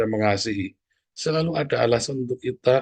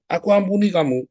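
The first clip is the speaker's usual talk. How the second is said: irritated forgiveness; 7 semitones higher, 4 dB louder than usual. Shown as a demonstration. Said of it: "Saking jengkelnya ya".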